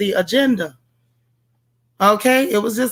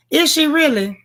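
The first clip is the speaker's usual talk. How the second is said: pitched-up doubtful question; skeptical disbelief; 6 semitones higher than usual. Real, not acted. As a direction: raise the voice in disbelief. Doubting chat's claim.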